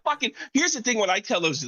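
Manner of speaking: high excited rush. Real, not acted. Excited laughing outburst.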